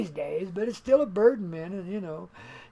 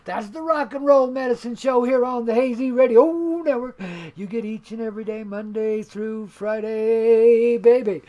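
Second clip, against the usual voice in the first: loud, higher on-air announcer hype; radio announcer; 4 semitones higher, 10 dB louder than usual. Acted. Host hyping his radio show to listeners, loud DJ announcer. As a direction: sell the show like a DJ.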